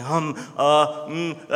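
imitating an excited girl's halting um and uh; stammering. Pastor imitating his niece who stammers when excited. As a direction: mimic her tripping over her thoughts. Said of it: "And she stammers".

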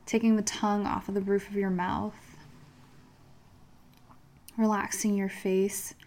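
soft, calm meditation guide; guided meditation. Acted. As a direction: lead them gently into calm.

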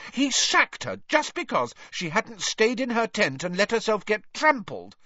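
high-pitched indignant outburst; heated. A narrator's reading. Hermione outraged at Winky's sacking, heated. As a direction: rail hotly, voice high throughout. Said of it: "said Hermione in a heated voice".

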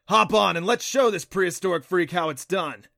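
loud, high, gung-ho shout; rally the team into battle; fired-up and boisterous; rallying cry. A narrator's reading. Creature rallies friends for the fight.

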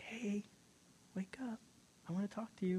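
high, hushed near-whisper; God calling softly. Retold or imitated. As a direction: gently rouse a sleeping child. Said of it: "God's like".

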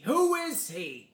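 high, loud, drawn-out mock demand; indignant outburst. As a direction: shriek it like a scandalised diva. Acted film quote, escalating demand.

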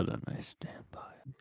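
hushed whisper. Acted. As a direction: whisper it like a secret. Suggestive aside.

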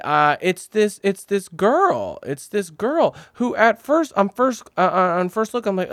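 ordinary talk, nothing marked

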